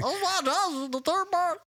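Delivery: high cartoon copy of a Southerner; goofy cowboy caricature; mocking imitation. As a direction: parrot him in a silly high voice. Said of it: "do I sound anything like what he's saying".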